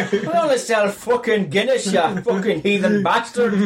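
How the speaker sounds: hammed-up Irish barman insult; mock Irish barman. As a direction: play an outraged Irish barman. Barman voiced in imagined pub.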